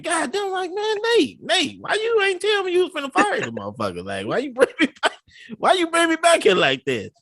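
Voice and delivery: high, disbelieving whine at the boss; mock-outraged "why didn't you tell me"; hammed-up betrayed complaint; incredulous protest. Retold protest to the manager.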